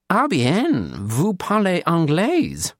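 high, slowing, badly accented French; hammed-up bad French accent. A narrator's reading. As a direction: stretch out the French like a tourist. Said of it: "he said in a bad French accent".

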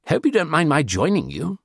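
high-pitched attempt at a French accent; lightly hammed-up French accent; bad French accent. A narrator's reading. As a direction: ask politely in a poor French accent. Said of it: "he said in a bad French accent".

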